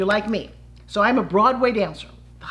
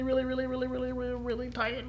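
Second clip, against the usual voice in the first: soft, monotonous run of repeats; mimicking endless winding; 2 semitones higher, 9 dB quieter than usual. Shown as a demonstration. Coach mocking people who wind and wind, droning repetition. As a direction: chant the repeats quietly on one held note.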